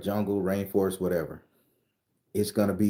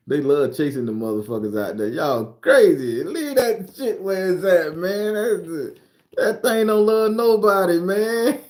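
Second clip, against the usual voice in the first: higher, louder, laughing disbelief; excited laughing rant; 10 semitones higher, 7 dB louder than usual. Real, not acted. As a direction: holler at them, amused and incredulous. Laughing, yelling at Aussies.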